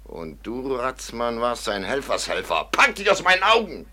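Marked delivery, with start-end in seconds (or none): 2.7-3.9 loud, high furious order; angry dismissal. Acted. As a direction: drive him away. Jagt Ratzmann fort. (wütend fortjagend)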